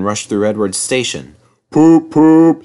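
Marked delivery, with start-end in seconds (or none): 1.7-2.6 loud, high train toot; engine whistle. A narrator's reading. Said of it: "he whistled".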